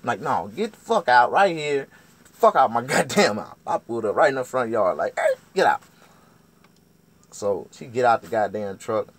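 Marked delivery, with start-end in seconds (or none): 0.2-3.6 very loud, high dismissal; loud acted kicking-out; yelled order. Acted yelling her out. 5.2-5.8 high, very loud holler; sharp acted shout; shouted command. Acted yelling her out.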